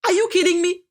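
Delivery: high-pitched incredulous challenge. Acted. Acting an incredulous reaction to apology.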